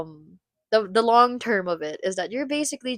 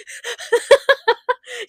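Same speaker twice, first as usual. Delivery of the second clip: long high giggle; sustained high-pitched laugh; 10 semitones higher than usual. Real, not acted. Laughs at own joke.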